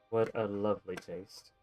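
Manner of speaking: ordinary talk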